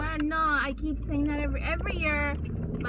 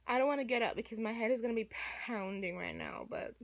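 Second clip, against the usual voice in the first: normal talking voice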